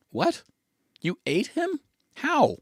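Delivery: high-pitched incredulous questioning; shocked disbelief. Acted. Companion learning the priest was eaten, shocked. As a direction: voice shoots way up in disbelief.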